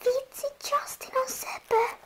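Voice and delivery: high, breathy secret whisper to a friend. Pretend. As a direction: whisper it so nobody hears. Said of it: "No tätä kuiskytte".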